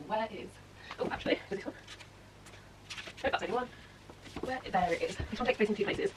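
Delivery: distant, off-mic murmuring; talking to herself across the room; far from mic. Real, not acted. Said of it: "I'm hoping you can hear me".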